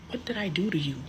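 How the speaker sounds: hushed unvoiced whisper. Real, not acted. Asking her sister in a hurt whisper. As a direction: breathe it out in a whisper.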